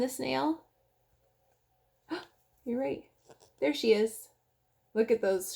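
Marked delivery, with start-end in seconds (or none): none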